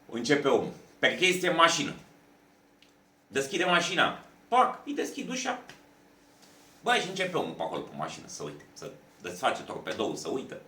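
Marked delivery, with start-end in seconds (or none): none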